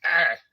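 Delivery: a short loud growl as he grabs; loud grunt of effort. Retold or imitated. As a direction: growl it out while grabbing. Grabbing the man's shirt.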